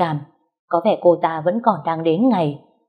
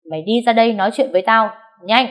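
fast, higher barked command; angry order; 4 semitones higher than usual. Acted. Wife ordering the mistress to come out, angry and fast. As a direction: snap the order at her.

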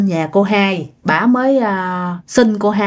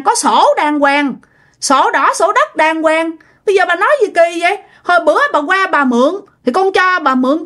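high, indignant retort, acted out; fed-up anger; 7 semitones higher than usual. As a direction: argue back in outrage, pitch held high. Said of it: "cô Hai mới tức quá".

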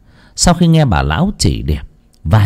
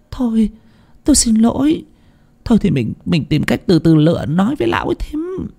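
pleading; 12 semitones higher than usual. Acted. Hiền apologising, coaxing.

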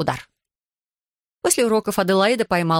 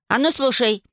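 higher-pitched cross command; angry order; 5 semitones higher than usual. A narrator's reading. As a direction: demand attention with some irritation. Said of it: "рассердилась Аделаида". (сердитый приказ, повыше)

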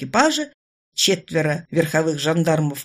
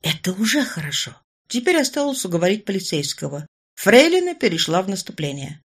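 ordinary delivery

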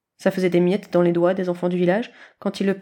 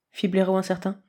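ordinary delivery, nothing marked

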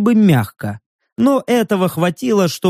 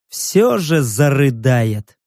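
usual speaking voice

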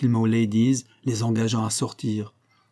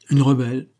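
"Une rebelle" repeated louder, with a lift on "Une"; "Une rebelle" thrown back at her, delighted; gleeful echo; 5 dB louder than usual. A narrator's reading. Henry gleefully echoing Shirley's word back at her, louder. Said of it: "répéta Henry".

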